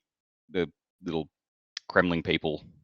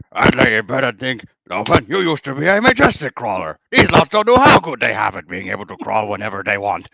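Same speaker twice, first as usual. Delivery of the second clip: Strong Bad voice; 3 semitones higher, 9 dB louder than usual. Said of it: "in Strong Bad's voice".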